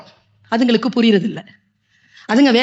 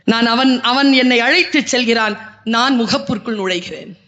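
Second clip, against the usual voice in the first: ordinary delivery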